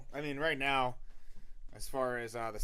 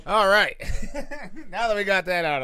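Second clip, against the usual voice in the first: laughing; 9 semitones higher, 12 dB louder than usual. Real, not acted. Laughing after tech trouble fixed.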